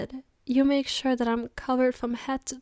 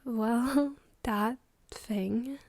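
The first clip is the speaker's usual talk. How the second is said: whispering; 5 dB quieter, much slower than usual. Acted. Shy confession of missing.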